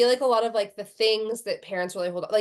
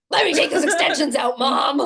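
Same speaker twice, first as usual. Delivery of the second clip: put-on 1 laughing kid shout; 4 semitones higher, 6 dB louder than usual. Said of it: "my kid's like".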